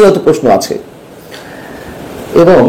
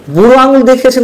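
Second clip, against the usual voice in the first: sarcastic repeat; 9 semitones higher than usual. Real, not acted. Commentator mocking the chief adviser to viewers, sarcastic and high. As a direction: pitch jumps much higher, with আঙুল hit hard and mocking.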